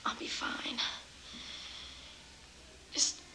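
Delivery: soft, frightened whisper. Acted. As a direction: whisper it, barely voiced. Frightened woman whispering to boyfriend.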